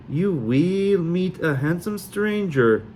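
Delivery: slow, high, hammed-up Sully accent; drawn out like a fake Sully fortune teller; slow mock Sully fortune telling. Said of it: "the most outrageously Sully accent".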